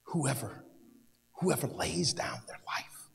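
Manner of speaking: hushed, slow reading of the verse; soft scripture reading. Acted. Preacher to congregation, soft hushed reading. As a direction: linger over each word softly.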